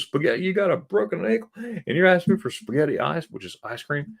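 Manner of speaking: high-pitched retold disbelief; incredulous. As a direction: imitate a baffled woman questioning a patient. Retells lady's incredulous question.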